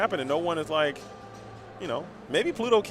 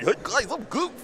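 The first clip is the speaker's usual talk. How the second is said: higher, goofy cartoon dog greeting; 7 semitones higher than usual. Streamer showing off his one Goofy voice to the guys, pitched higher. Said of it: "I do Goof".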